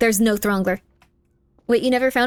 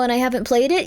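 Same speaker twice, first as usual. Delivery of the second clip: ordinary talk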